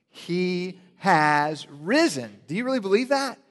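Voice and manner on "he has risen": very slow, drawn-out call to the congregation; slow and louder, risen falling at the end; slow proclamation. Shown as a demonstration. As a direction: stretch it out for all to repeat. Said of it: "Say that with me".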